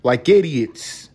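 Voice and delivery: imitated New York old-timer, drawn out; old New York accent. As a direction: stretch it out like an old-time New Yorker. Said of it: "that's how people talk back then".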